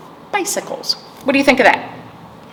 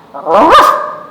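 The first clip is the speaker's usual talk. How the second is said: high loud ruff; dog bark; 9 semitones higher, 6 dB louder than usual. A narrator's reading. Storyteller barking as Dog at Duck.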